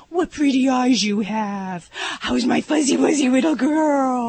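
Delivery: put-on baby talk. Shown as a demonstration. Vet demonstrating baby talk to a little dog, cooing slowly. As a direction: gush over the pet in baby talk. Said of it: "go with it".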